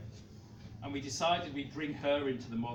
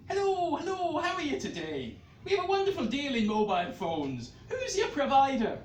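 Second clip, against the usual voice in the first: phone salesman; 9 semitones higher, 7 dB louder than usual. Acted.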